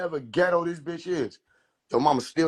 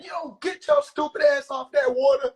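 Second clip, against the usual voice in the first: mama yelling; 10 semitones higher than usual. Acted.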